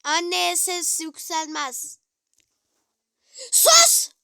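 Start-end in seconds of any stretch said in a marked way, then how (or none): 3.5-4.1 sudden high, loud "Sus!"; hissed-out shush, loud and high; sharp hush. Real, not acted. Mother hushes her son.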